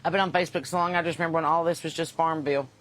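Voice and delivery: usual speaking voice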